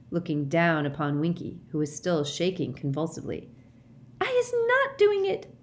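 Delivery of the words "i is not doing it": very high, squeaky tearful squeal; the elf squeals her innocence in tears; squealing house-elf. A narrator's reading. Winky pleading with Mister Diggory, high tearful squeal. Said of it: "she squealed".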